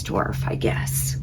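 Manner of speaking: barely voiced afterthought; whispered aside. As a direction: breathe it to yourself, deflated. Muttered aside.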